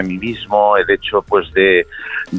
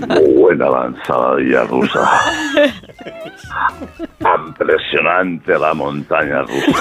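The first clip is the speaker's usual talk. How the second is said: very slow, drawn-out faux-impressed praise; mock admiration; slower than usual. Andalusian scraping up praise for Russia, slow mock admiration. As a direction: stretch each line with deadpan grandeur.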